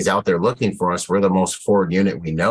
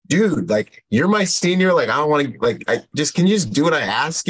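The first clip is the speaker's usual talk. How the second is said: higher pitched, pleading with a stubborn senior; a young squad leader begging to be obeyed; mock-exasperated dude, come on; exasperated plea; 7 semitones higher than usual.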